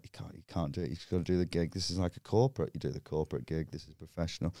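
low, very quiet, hurried pep talk; muttered self-talk. Retold or imitated. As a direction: talk yourself down under your breath. Muttered self-talk before the gig.

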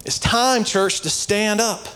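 high-pitched, emphatic sermon delivery; fired-up preaching. Real, not acted. Preaches with raised voice.

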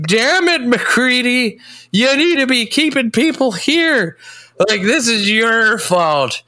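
hammed-up boss scolding an employee; mock angry boss. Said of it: "He's like".

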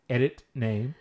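normal talking voice

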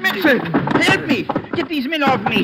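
high, loud cry for help; shouting for help. Acted. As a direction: yell for help while being attacked. Calls for help during fight.

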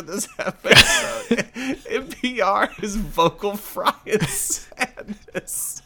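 real high-pitched laughing fit; helpless laughter. Real, not acted. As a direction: squeal the words through laughter.

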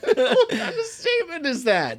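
high, loud laughing protest; amused disbelief. Real, not acted. Co-host still stunned by the dog review, high laughing disbelief. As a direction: protest it through real laughter. Repeats outrage while laughing.

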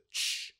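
short fizzy "tshh"; unpitched burst of escaping fizz; can opening hiss. Retold or imitated. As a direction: imitate a can cracking open. Imitates canister opening.

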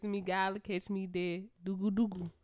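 relaxed, half-African Jamaican accent. Shown as a demonstration. Translating for the co-host, rough Jamaican accent. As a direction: repeat it in a bad Jamaican accent. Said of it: "That's my African Jamaican accent".

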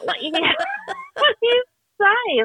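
high laugh breaking into the words; real laughter, high and strained; helpless laughter. Real, not acted. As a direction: laugh till the voice cracks high. Recalling the bondage suits.

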